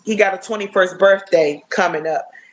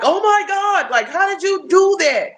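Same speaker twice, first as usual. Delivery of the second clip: son's amazement; 11 semitones higher than usual. Retold or imitated. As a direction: imitate a boy stunned by a wall sit. Mom voicing son's amazed reaction.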